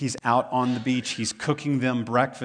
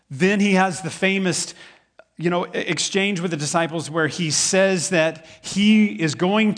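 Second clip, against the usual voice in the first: raised, driving sermon voice; louder, higher pulpit delivery; preaching at full force; 5 semitones higher, 7 dB louder than usual. Acted. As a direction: preach it out to the room. Preaching loudly about Jesus' arrest.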